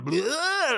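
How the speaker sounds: high cartoon blurt; goofy bleh. Imitating the dorky scientist, high silly blurt. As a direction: spit out a silly noise. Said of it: "And he's, like, going".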